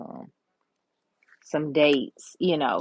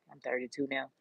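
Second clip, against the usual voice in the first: whispered aside; 4 semitones lower, 11 dB quieter, faster than usual. Real, not acted. Whispered aside about her age.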